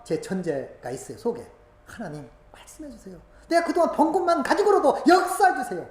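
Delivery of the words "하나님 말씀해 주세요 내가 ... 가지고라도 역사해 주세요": pleading prayer rising louder and higher; fervent prayer quote. Retold or imitated. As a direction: beg God to speak.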